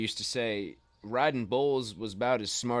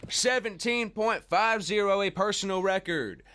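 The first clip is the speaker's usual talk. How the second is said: high, hammed-up play-by-play call; mock rodeo announcer; 8 semitones higher than usual. Uncle calls niece's practice run like a rodeo announcer, playful high play-by-play. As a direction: call her ride like a rodeo announcer for laughs. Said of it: "You'd make a good announcer".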